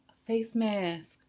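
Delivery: soft hushed teaser before the reveal; teasing hush. Shown as a demonstration. Host teasing her viewers before the big reveal, hushed. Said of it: "a triple clay face mask".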